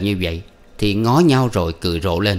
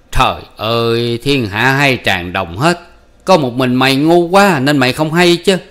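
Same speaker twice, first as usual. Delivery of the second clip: slow, louder, then high and loud; exasperated elder; 4 semitones higher, 5 dB louder than usual. Acted. Tam marveling that Sủ alone doesn't know, exasperated. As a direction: groan at the fool, then jab him.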